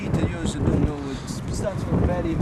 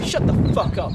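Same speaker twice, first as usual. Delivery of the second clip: loud sharp snap at a heckler; angry loud rebuke; snapped shut up; 2 semitones higher, 7 dB louder than usual. Real, not acted. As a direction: bark it at the guy. Shouting at someone off camera.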